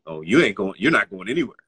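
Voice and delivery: dismissive mock guard, first words punched loud; condescending. Security guard refusing student musicians at the elevator, condescending. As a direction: imitate someone talking down to a student. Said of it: "his attitude was really condescending".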